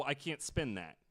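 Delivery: ordinary talk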